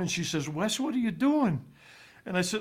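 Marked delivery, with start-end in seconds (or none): 0.5-1.6 a lightly higher, puzzled mom voice; mother's puzzled question. Retold or imitated. Son recalls his mother at the airport, puzzled. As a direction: ask it the way a startled mother would.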